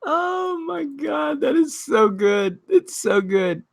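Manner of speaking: high, slow words squeezed out through laughs; cracking up and barely getting words out; helpless laughter. Real, not acted. Reacting to a tweet to chat, laughing hard. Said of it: "cracking up at tweet".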